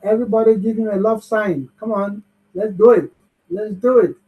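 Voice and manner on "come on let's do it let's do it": ordinary talk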